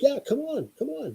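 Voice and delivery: lightly voiced Santa gently urging; Santa insisting. Santa coaxing the couple to skip the line, high and soft.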